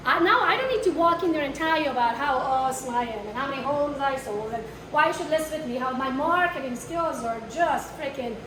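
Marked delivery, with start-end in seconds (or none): none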